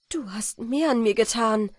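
emphatic, moved insistence; fervent gratitude. A narrator's reading. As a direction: answer with strong feeling. Countess fervently thanks her godson.

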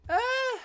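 high sudden yelp; short shocked cry; startled yelp. Real, not acted. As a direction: jump at a surprise. Startled in game.

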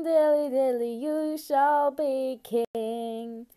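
ordinary talk, nothing marked

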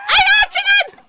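high, loud triumphant yell; excited shout. Real, not acted. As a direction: shout with glee at the catch. Catching a chicken.

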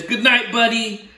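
lightly voiced octopus at bedtime. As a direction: say good night as the cheeky octopus. Octopus says good night.

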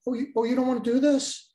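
a taunting officer offering a way out; mock-friendly officer. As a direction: sneer it out with false kindness. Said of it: "in typical fashion".

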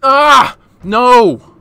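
high frantic shriek; panicked screaming. Real, not acted. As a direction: shriek as the fight collapses. Fight coming apart.

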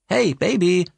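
high, loud call down the line; brother calling. Acted. Brother calling from the phone after the crash, high. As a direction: shout for her through the phone.

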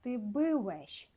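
Polish "я бивем" drawn out slowly, every sound clear; slow Polish demo. Shown as a demonstration. Teacher modelling the Polish form for students, very slowly. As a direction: say "я бивем" slowly for learners to copy. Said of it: "«Би» плюс «вещ»".

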